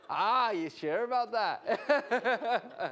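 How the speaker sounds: slow, loud mock-Irish taunt; drawn-out Irish goading, laughing after; imitated Irish accent. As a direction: tease knowingly in an Irish accent. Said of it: "I was in Ireland, and the guy kept going".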